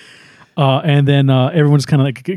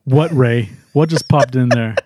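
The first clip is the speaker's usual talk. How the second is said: stern questioning; 2 semitones lower, slower than usual. Retold or imitated. Teammate questioning Ray about his thought, dryly stern. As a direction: drop the voice and press for the answer. Teammate answers Ray's confession.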